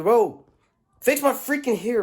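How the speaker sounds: high, loud exasperated bro; exasperated outcry. Real, not acted. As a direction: cry out fed up. Exasperated outburst at barber.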